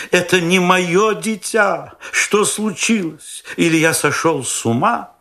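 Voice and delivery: high, slow, frantic disbelief; a father in horror at the wrong child; panicked father. A narrator's reading. Father cries out to narrator in high frantic panic. Father finds a puppy, not baby.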